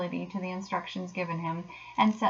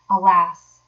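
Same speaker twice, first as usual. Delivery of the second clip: plain reading voice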